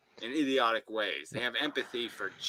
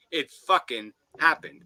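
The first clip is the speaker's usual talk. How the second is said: emphatic shout; 4 semitones higher, 9 dB louder than usual. Real, not acted. Insisting in heated rant.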